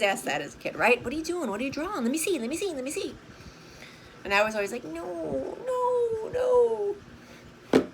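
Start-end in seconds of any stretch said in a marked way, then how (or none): 1.0-3.1 nosy pestering adult. Retold or imitated. Nosy adults asking to see drawing. 4.8-7.8 lightly voiced shy child, pitch climbing on each no; a hint of a bashful kid saying no no no; shy kid refusing. Child hiding drawing from nosy grown-ups, shy pitch-rising refusal. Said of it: "I was super silly, super shy".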